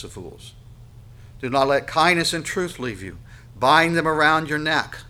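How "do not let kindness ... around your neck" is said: loud, emphatic verse with raised pitch; forceful scripture reading. Acted. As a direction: drive the command home.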